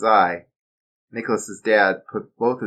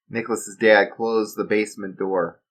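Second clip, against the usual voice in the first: ordinary delivery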